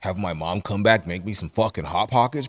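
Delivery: mock lazy pothead dude. Stoner praising an easy life, mock pothead voice.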